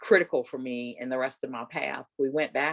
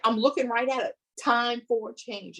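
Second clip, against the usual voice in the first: insisting excitedly; 10 semitones higher than usual. Retold or imitated. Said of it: "And I was like".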